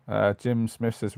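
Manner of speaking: normal talking voice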